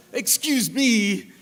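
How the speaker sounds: slow, put-on French accent, high and loud, through a laugh; laughing imitation of a French-accented English speaker; mock French accent. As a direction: stretch out a hammy French-accented excuse me. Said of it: "speaks English with a French accent".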